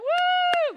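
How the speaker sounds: very high, loud "woo"; imitated crowd cheer. Onlookers react to a public proposal, whooping. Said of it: "And people are like".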